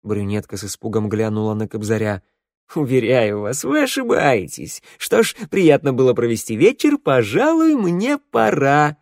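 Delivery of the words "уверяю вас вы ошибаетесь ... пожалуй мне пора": airy dandy. Acted. Kobzar smoothly excusing himself.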